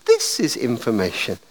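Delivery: a lightly voiced switchboard operator. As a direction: answer like the telephone operator. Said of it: "And a voice says".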